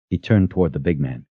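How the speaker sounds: usual speaking voice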